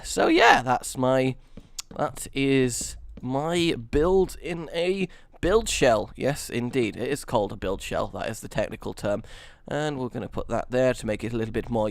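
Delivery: ordinary delivery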